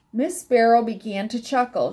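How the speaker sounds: ordinary delivery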